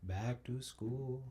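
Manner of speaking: slow, soft, dragged-out dread; slow drawn-out. Shown as a demonstration. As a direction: drag it out quietly like a sigh. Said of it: "let me go back to school".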